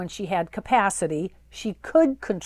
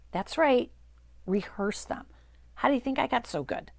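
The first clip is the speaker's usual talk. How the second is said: ordinary talk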